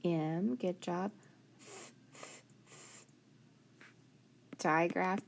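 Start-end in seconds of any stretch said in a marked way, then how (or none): 1.6-3.1 three soft puffs of "th"; voiceless "th", no vowel, spaced apart; isolated TH sound. Shown as a demonstration. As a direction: breathe out "th" as a bare sound. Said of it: "we're gonna try our spelling sounds".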